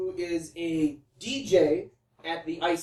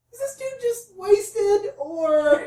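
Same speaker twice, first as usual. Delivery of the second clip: incredulous; 11 semitones higher, much slower than usual. Retold or imitated. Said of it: "'Cause I'm like".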